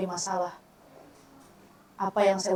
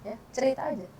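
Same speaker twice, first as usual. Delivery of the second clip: ordinary talk, nothing marked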